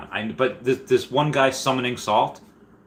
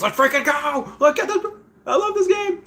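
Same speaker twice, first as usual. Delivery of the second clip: hyped fan shout. Pretend. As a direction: scream it like a kid at a game. Reading hyped chat message.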